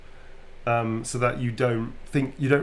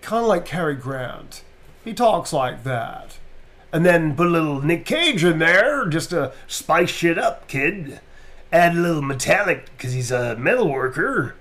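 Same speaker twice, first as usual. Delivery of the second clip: Cary Grant transatlantic with some Nick Cage spice; swooping transatlantic character voice; Heisenberg, transatlantic; 5 semitones higher, 4 dB louder than usual. Shown as a demonstration. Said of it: "he's got transatlantic accent".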